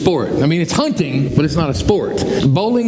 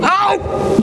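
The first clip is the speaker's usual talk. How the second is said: high yelp. Retold or imitated. Kid regrets back talk.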